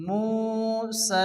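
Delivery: মুসা chanted slow and high with the সি drawn out; recited pronunciation. Shown as a demonstration. Teacher demonstrating the elongated reading in recitation. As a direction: stretch মুসা like Quran tilawat. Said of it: "এরকম উচ্চারণ করতে হবে".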